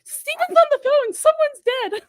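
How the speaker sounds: high, loud panicked cry; panicked aunt. Retold or imitated. Aunt waking her sister, high panicked announcement. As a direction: blurt it out in alarm. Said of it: "So Christine".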